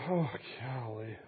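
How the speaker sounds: low, soft, weary groan of words; mock exasperation. Jesus dealing with these people, mock weary exasperation. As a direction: let it out quietly, fed up.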